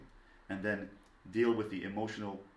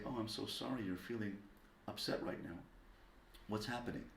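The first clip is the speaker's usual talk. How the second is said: soft, soothing concern; hushed, caring parent voice; sympathetic; 10 dB quieter than usual. Acted. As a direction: gently acknowledge a child's upset. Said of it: "acknowledge the feeling".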